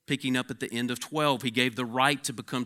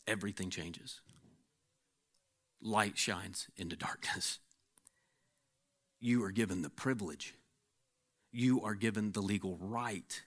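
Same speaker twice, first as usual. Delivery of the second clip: hushed; 3 semitones lower, 6 dB quieter than usual. Acted. Quiet intimate stretch after whisper.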